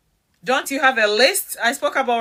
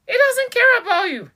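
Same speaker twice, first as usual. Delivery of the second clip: "He doesn't care about you" much higher, hitting "care"; high emphatic verdict; 10 semitones higher than usual. Real, not acted. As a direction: drive it home to the listener.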